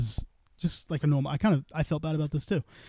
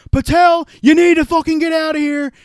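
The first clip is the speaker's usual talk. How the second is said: imitating him yelling, much higher and louder; retold yell; 12 semitones higher, 12 dB louder than usual. TikTok streamer kicking Patel out of his live, imitated high loud yell. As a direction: mimic the furious streamer throwing you out.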